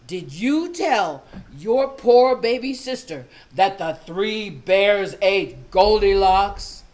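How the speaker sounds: loud, slow stern questioning; angry mother. Retold or imitated. Mother grills daughter, loud and slow. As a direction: lean on every word like a mad mom.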